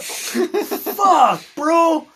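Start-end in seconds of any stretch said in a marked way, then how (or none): none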